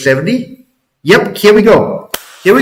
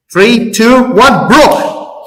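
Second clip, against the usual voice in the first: excited countdown; 6 semitones higher, much slower than usual. Real, not acted. Live countdown to price break.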